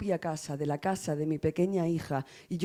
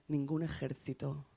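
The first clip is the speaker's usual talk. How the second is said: fading to near silence; 3 semitones lower, 9 dB quieter than usual. Acted. Poet closing the poem on grief, softly fading out. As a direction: drop much softer for the last words. Poem's closing lines fade out.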